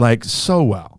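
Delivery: drawn-out so well; stretching it out, so well; slow emphasis. Real, not acted. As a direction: savor so slowly. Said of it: "And it's going so well".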